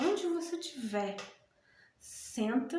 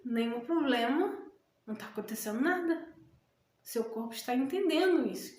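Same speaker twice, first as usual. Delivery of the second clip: ordinary talk, nothing marked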